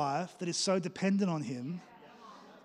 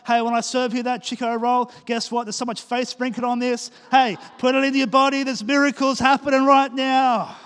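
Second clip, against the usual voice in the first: louder, bright pitch selling a roll full of faith; enthusiastic cafe server; 4 semitones higher, 7 dB louder than usual. Acted. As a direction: hype up the customer like a hawker. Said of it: "the cafe team starts going".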